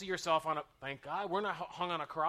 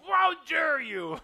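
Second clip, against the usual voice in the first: higher, louder, slow offended cry; mock indignation; 9 semitones higher, 14 dB louder, slower than usual. Preacher mimicking a driver who gets honked at: mock outrage. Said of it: "they honk at you".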